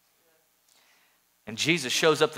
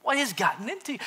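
outraged Jesus; 12 semitones higher, faster than usual. Retold or imitated. Jesus confronting the merchants, high and indignant. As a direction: cry out high, scandalized. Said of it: "he had a little bit of passion".

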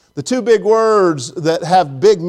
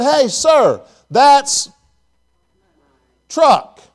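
raised, higher call across the parking lot; retold call-out; 7 semitones higher, 4 dB louder, much slower than usual. Preacher retells calling to a man at his truck, raised and higher. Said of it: "the first thing I said".

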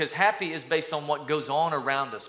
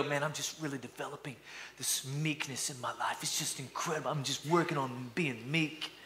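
very soft, hushed mock-earnest confiding; mock meek; 9 dB quieter than usual. Man telling friends what's new in life, mock meek and very soft. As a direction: gently parody a meek man sharing his news. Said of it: "Nobody talks like that".

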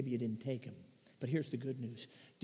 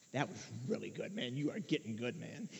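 low, soft self-congratulation; a hint of smug inner voice, hushed; inner proud thought; 7 semitones lower, 8 dB quieter than usual. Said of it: "I'd have this thought".

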